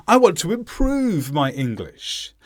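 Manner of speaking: "improve" stretched and prominent, the tail falling away; stress on IMPROVE. Shown as a demonstration. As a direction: make "improve" the key word of the contrast. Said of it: "improve becomes the most important word".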